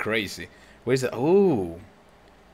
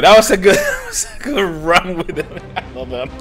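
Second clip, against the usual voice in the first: usual speaking voice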